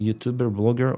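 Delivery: ordinary talk, nothing marked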